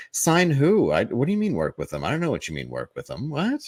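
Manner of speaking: imitating baffled denial, high on the questions; feigned confusion. Agent dodging a client's question, feigning confusion. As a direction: play dumb, quick, ending on a high-pitched what. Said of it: "sort of this very roundabout way".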